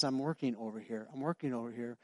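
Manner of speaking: a hint of God, softly, under his breath; quietly busy, as God; hushed God voice.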